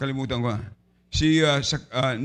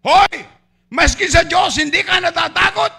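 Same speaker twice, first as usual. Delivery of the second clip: much higher, loud scolding yell; rebuking shout; 10 semitones higher, 7 dB louder than usual. Acted. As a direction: snap at the other thief. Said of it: "sabi niya".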